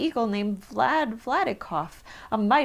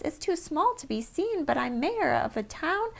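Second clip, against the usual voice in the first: higher little voice calling up from a speck; small town mayor, higher pitched and proud; tiny mayor calling; 6 semitones higher than usual. A narrator's reading. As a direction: call up from far below, tiny and proud. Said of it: "called the voice".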